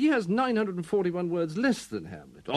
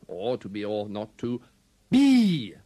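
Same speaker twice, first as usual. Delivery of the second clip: final "be" blasted out after a pause; low run-up, then a huge loud "be"; one word louder; 5 semitones lower, 6 dB louder, slower than usual. Shown as a demonstration. Actor showing louder words, final "be" boomed. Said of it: "say one word louder than another".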